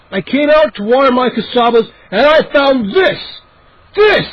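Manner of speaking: high, loud, slowing outrage; outraged. Acted. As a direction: build to a shrieking peak. Angry neighbor shows ruined melons.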